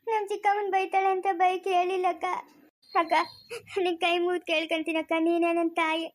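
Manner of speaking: subdued, tearful begging; weepy, helpless entreaty; crying plea. Acted. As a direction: plead with the neighbour while crying. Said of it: "ಅಳ್ಬೇಡ ಸುಮ್ನಿರು".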